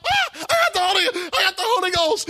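high, loud elated cry; ecstatic shout. Retold or imitated. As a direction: shout it out overjoyed. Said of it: "I'm like".

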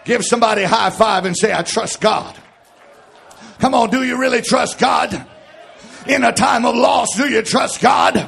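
shouted, ringing sermon cadence; rousing pulpit shout over music; full-force preaching. Acted. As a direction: preach it out to the whole church.

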